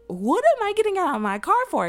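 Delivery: high, loud, baffled question; indignant surprise. Retold or imitated. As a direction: protest it in shocked disbelief. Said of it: "And I was like".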